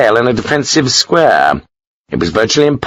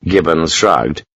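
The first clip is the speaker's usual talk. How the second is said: usual speaking voice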